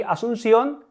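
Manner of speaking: ordinary talk, nothing marked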